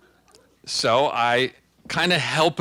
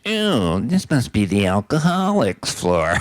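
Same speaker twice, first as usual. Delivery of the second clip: Truman Capote voice; 3 dB louder, slower than usual. Retold or imitated. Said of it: "and he said".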